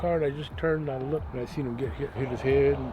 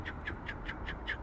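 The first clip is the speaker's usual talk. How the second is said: whispered choo sounds; 14 dB quieter, faster than usual. Retold or imitated. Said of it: "I just seen him going".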